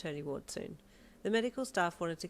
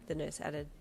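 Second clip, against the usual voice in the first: usual speaking voice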